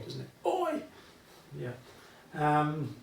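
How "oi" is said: a high, playful British "Oi!" call; jokey oi. Pretend. Teacher joking that the notation reads oi, playful high call. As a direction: sing out "Oi!" like hailing a mate. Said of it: "Looks like I'm writing oi".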